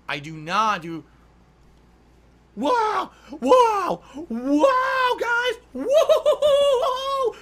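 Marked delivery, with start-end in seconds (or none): 2.5-7.3 loud, very high whooping wows; excited shouting. Real, not acted. Streamer yelling to chat in wild excitement.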